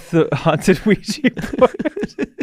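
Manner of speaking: slow words breaking into laughter; laughing while talking. Real, not acted. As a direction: struggle to get it out through giggles. Cracking up at a remembered bit.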